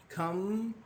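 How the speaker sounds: "Come" held on a closing hum; held closed syllable. Shown as a demonstration. Teacher showing the airstream blocked, "Come" held on its final m. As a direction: stretch the m of "Come" until the air stops. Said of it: "you cannot breathe anymore".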